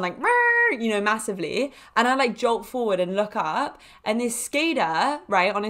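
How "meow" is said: high imitated horn blare; car horn honk. Driver honks down on the horn, high vocal honk. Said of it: "honks down on the horn".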